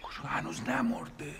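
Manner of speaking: hushed, secretive whisper. Acted. Husband whispering to his wife that the uncle isn't dead yet. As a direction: keep it low so others won't hear.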